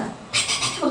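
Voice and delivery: breathy unvoiced "탁탁탁탁탁탁" patter of feet; whispered footstep sounds. Telling how she hurried to church, whispered footsteps. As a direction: patter the steps out under your breath.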